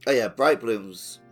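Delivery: ordinary talk, nothing marked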